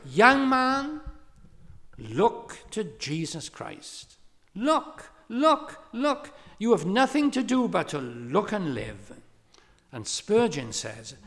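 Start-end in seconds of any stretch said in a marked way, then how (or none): 0.0-9.2 high, loud preacher's cry, easing briefly between calls; ringing, insistent mock pulpit shout; retold shout. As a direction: imitate a preacher calling out urgently from the pulpit. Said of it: "he shouted".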